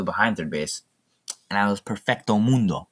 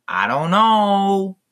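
slow, high drawn-out groan; exasperated; 10 semitones higher, 5 dB louder, much slower than usual. Real, not acted. Host despairing at the news, drawn out. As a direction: stretch it out hopelessly.

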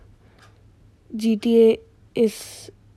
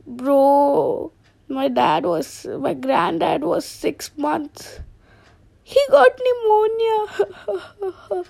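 higher chicken voice breaking into a mock sob; chicken crying; 6 semitones higher, much slower than usual. Chicken telling his friend grandfather died, mock crying. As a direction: whimper it out like a grieving cartoon chicken.

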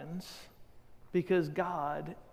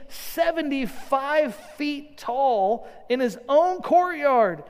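eager, pitched-up herald; really excited; 5 semitones higher, 7 dB louder than usual. Retold or imitated. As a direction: burst in high and eager. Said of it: "really excited".

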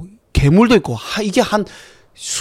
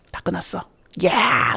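breathy hushed celebrating; whisper; 3 semitones higher, 7 dB quieter, slower than usual. Retold or imitated. Researchers toast at the party, whispered cheer. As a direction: whisper it gleefully. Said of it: "이러면서".

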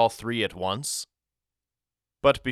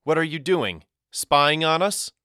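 higher, louder accusing question; suspicious demand; 7 semitones higher, 6 dB louder than usual. A narrator's reading. Boy to the brother caught following, demanding. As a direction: demand an answer, pitched up and pressing. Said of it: "he demanded".

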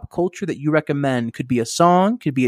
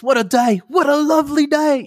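badly attempted British accent, pitched high; 10 semitones higher than usual. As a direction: quote it in a shaky stab at British. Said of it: "trying to do a British accent".

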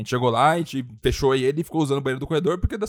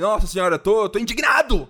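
indignação teatral, aguda e arrastada; Gilberto indignado; 6 semitones higher, 3 dB louder than usual. Retold or imitated. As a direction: esticar a palavra num protesto exagerado. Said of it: "que nem o Gilberto do B B". (indignado de novela, bem arrastado)

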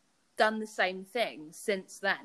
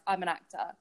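confident claim; 2 semitones lower than usual. Shown as a demonstration. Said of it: "say it with my chest".

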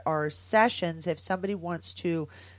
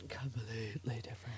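unvoiced whispered repeat; 4 semitones lower, 14 dB quieter than usual. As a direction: whisper it under your breath. Whispered echo of own phrase.

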